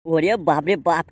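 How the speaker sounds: amazed gasp. Acted. Old father stunned at the bride's looks, slow amazed exclaim. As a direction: drag it out in awe.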